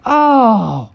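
high wailed groan; long falling "oh"; mock dismay. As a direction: cry out in storybook dismay. Rattling starts again.

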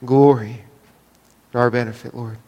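low, soft prayer voice; quietly closing the prayer. Acted. Closing prayer before sermon.

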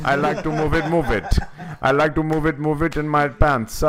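high, bouncy cartoon accent; King Julian. Showing who the accent sounds like, high cartoon impression. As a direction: belt it out in a high, silly accented voice. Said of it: "sounds more like King Julian".

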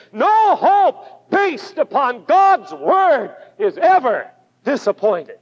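a firm preacher's declaration, each word weighed; emphatic. Shown as a demonstration. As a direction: lean into "No" and drag the phrase slowly. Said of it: "I say it emphatically".

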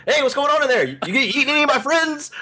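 high-pitched cartoon shellfish; indignant oyster voice. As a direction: challenge them as a nosy little oyster. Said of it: "they were, like, coming out like".

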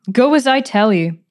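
stern bark of a command; sharp command. Acted. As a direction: snap the order at the girl. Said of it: "she commanded the girl sharply".

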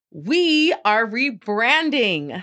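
drawn-out big reveal; slow announcement. Real, not acted. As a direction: announce it slowly for effect. Announcing the rebrand.

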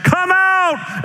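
high-pitched hollered command; commanding call. Acted. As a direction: call it out as if into every grave. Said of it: "if he had simply said".

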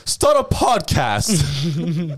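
slow, drawn-out mock guru line; sarcastic slow quote; mock advice. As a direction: drag it out like a smug life coach. Mocking Jay Shetty's advice.

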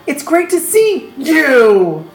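drawn-out, overjoyed greeting; hammed-up reunion joy; acted delight. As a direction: greet a long-lost friend, stretching it out.

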